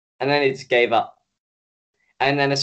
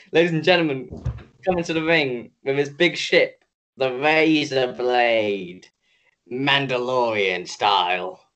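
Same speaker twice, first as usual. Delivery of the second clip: mock ring announcer. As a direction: announce it like a wrestling entrance, stretching the final names. Mock ring announcer introducing the ship.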